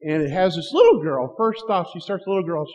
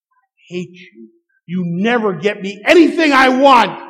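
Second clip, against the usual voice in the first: put-on furious teenage yell, getting louder; teen's angry shout; 3 semitones higher, 9 dB louder than usual. Teenage daughter yelling at her dad, angry shout. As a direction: spit it at your father, rising to a shout. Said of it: "She says".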